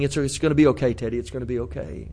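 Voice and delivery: put-on soft, hushed comfort to a crying child; calm him down gently, almost under your breath; a father hushing his son softly; soothing hush.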